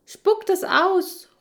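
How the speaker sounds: high, slow, loud shout at the dog. Acted. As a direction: call out sharply and drawn out. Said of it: "rufe ich".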